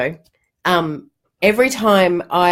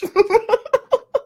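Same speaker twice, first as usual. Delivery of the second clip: a long laugh rising much higher. Real, not acted. Mother in the car with daughter, high rising laugh. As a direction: laugh it up, delighted. Laughing at her own idea.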